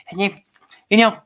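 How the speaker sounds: lightly voiced rambling old woman; a hint of a doddering politician; mildly imitated Pelosi; mock Nancy Pelosi. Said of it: "she said".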